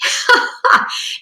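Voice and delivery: short burst of laughter; amused laugh. Real, not acted. Lecturer amused by Milton's barbarous age remark, amused laugh. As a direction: break off reading to laugh. Amused by the quote being read.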